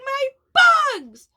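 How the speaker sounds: high, loud anguished cry; screech. A narrator's reading. Eddie screeching over his eaten bugs. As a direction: wail it like the bugs are gone for good. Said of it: "Eddie screeched".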